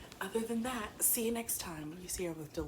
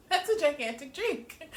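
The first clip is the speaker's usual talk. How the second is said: laughing protest; 9 semitones higher, 7 dB louder than usual. Retold or imitated. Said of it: "I'm like".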